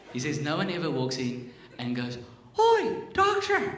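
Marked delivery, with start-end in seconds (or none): 2.6-3.7 put-on high, loud Irish accent. Patient seeking pot of gold tells doctor, Irish accent. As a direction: lift it high into a hammed-up Irish lilt. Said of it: "this is my best one".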